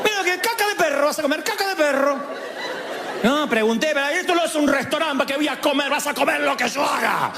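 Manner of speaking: high, exasperated mother telling off; scolding mother. Retold or imitated. Mother scolding child about dinner, high and exasperated. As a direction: lay down the law about dinner. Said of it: "O la mía era".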